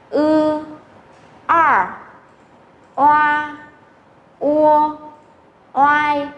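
"Ư", "R", "Oa", "Ua", "oai" each held long and high; model finals recited. Shown as a demonstration. Teacher reviews finals for students, each from "Ư" to "oai" drawn out. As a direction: sound out "Ư", "R", "Oa", "Ua", "oai" singly, evenly.

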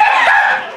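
high-pitched burst of real laughter. Real, not acted. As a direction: crack up in a high voice. Laughing at a game mishap.

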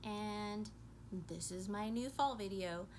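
hushed, flat voiceover so no one wakes; quiet monotone voice. Real, not acted. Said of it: "I sound very monotone".

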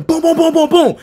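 high, rapid-fire booms; punch sound effects. Retold or imitated. Storyteller acting out beating the prefect, punch sound effects. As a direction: rattle off the blows.

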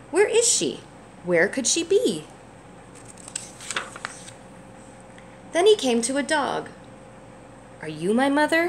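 gentle, lively picture-book read-aloud; reading to a child. A narrator's reading. Parent reading a picture book to a child, gently lively. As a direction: read it warmly for a little one.

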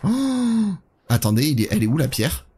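ordinary talk

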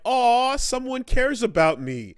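mock being touched, then snap it away; playful sarcastic couple teasing; mock teasing banter.

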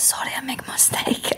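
put-on whispered Spanish-accented apology; soft mock-apologetic whisper with Spanish accent; imitated Spanish masseuse. Spanish masseuse apologizing to client, imitated whisper. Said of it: "like, this beautiful accent".

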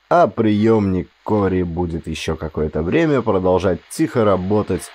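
ordinary delivery, nothing marked

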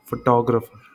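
Photographer with a strong TAW; stress on second syllable. Shown as a demonstration. Teacher contrasting three example words, stress on TAW. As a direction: say fo-TAW-gruh-fer for photographer. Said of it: "इस syllable पे ज़्यादा stress दिया".

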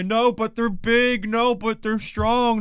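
high, slow mocking whine; nasal mock of a defensive Aussie fan; mocking whiny voice. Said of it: "He's trying to say".

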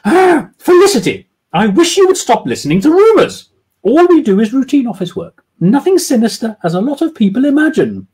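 high, loud woman cutting it short; curt lady voice; abrupt. Acted. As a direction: shut down the rumours abruptly. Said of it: "Jemima said abruptly".

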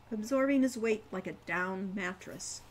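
ordinary delivery, nothing marked

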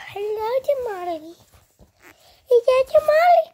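very high squeal to a dog; excited pet talk. Real, not acted.

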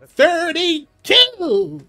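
high, slow, drawn-out yell; shouted countdown. Acted. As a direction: bellow the number long and loud. Countdown to drive off.

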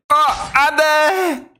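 high, drawn-out cry of alarm; panicked shout. Real, not acted. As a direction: yell it out as the hit lands. 게임 중 위기에 비명. (길게 끄는 다급한 비명)

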